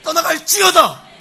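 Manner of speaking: loud exorcism command, climaxing in a shout; commanding proclamation. Acted. Parent casting Satan out in Jesus' name, commanding shout. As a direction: order the devil out with full force. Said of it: "그렇게 날마다 선포하며 고백한 거예요".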